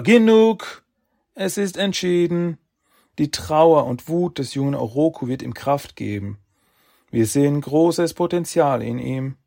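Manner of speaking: loud, high furious verdict; angry shout. Acted. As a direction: slam the decision down. Sages shout final verdict.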